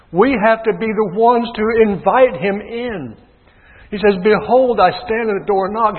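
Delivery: high, loud revival preaching; full-force preaching. Acted.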